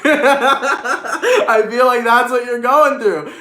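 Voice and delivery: laughing through the words, slowed way down. Real, not acted. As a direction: crack up while repeating the line. Laughing at own joke.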